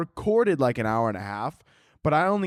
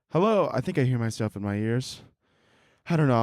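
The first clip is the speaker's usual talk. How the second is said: ordinary delivery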